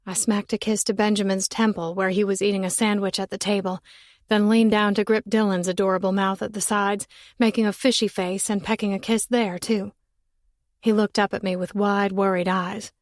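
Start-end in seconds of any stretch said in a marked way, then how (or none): none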